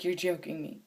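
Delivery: hushed, disbelieving mutter; quiet disbelief. Retold or imitated. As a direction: say it low to yourself in disbelief. Retelling her disbelief, first take.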